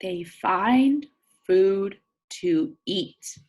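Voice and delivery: slow, clear read-aloud; reading to children. Acted. Teacher reading a picture book to her class. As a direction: read each word out for young listeners.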